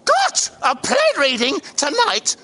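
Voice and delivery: usual speaking voice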